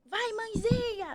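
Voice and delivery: high, slow, drawn-out urging; mock coach chanting push; pushy midwife. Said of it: "ela era muito assim".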